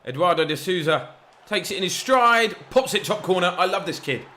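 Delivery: real thrill as the ball goes in; excited goal call. Real, not acted. Commentator hailing the young player's goal, excited.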